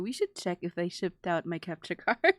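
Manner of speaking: normal talking voice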